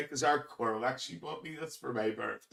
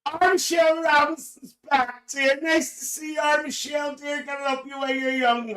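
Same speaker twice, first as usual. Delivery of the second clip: shouting; 10 semitones higher than usual. Real, not acted. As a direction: call it out as if across a hall. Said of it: "I can stop shouting".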